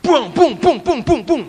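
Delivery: high, repeated "bung" sounds; imitated energetic bursts. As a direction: punch out a string of high bursts. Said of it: "Orangnya itu energik sekali".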